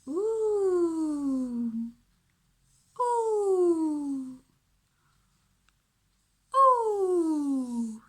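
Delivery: loud high sustained "Ooh"; ooh sound demo. Shown as a demonstration. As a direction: sing out the "ooh" while tracing. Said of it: "And we say".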